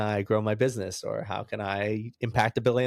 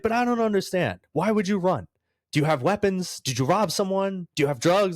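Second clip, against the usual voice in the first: mock cop yelling, much higher pitched; 9 semitones higher, 3 dB louder than usual. Cop grilling the speeding driver, hammed-up high yelling. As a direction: imitate an angry cop firing questions. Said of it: "Now he's yelling at me some more".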